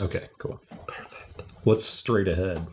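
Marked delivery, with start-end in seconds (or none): 0.0-1.4 whispering. Real, not acted. Player whispering a plan.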